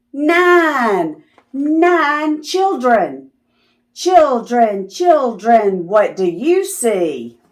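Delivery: ordinary delivery, nothing marked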